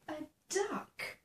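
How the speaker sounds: ordinary delivery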